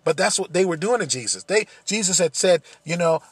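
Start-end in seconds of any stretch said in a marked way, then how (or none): none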